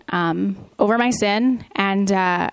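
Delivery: ordinary talk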